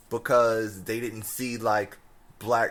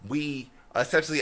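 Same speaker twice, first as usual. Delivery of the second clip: normal talking voice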